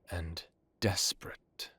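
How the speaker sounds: grave hushed warning; ominous whisper. Acted. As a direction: breathe it out, slow and soft. Ominous warning about the son.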